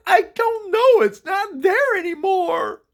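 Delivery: loud, high, drawn-out wail; crying hard. Retold or imitated. Kid to sister searching for vanished house, crying hard. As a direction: wail it out in acted tears. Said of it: "I started crying really hard".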